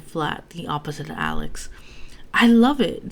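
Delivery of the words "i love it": higher, much louder burst of joy; excited. A narrator's reading. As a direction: shout it like a kid tearing open a gift. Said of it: "said Calyx excitedly".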